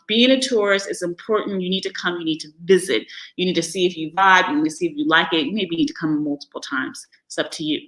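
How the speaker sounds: ordinary talk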